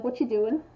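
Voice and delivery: "What you doing" run together as one casual word; relaxed, blended "What you doing". Shown as a demonstration. Crafter showing relaxed local speech, words run together. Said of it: "putting words together".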